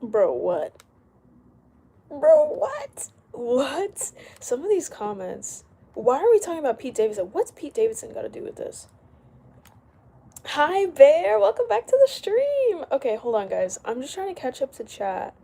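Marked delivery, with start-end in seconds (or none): 2.1-4.1 louder, much higher disbelieving repeat; incredulous. Real, not acted. Streamer reacting to a baffling comment, high and incredulous. 10.5-12.9 loud, very high sing-out welcome; high-pitched greeting. Real, not acted. Streamer greeting a returning viewer in a loud, high voice.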